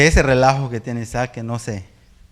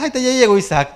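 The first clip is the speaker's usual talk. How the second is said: higher, louder exasperated sigh-line; mock exasperated; 11 semitones higher, 5 dB louder than usual. Parent in the bedroom hearing son arrive, mock exasperated. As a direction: roll your eyes at the noisy son. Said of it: "está".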